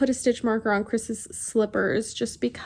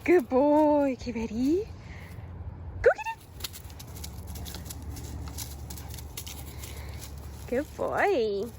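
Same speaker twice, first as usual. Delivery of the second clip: talking to a dog; 3 semitones higher, 6 dB quieter than usual. Real, not acted. Praising the dog.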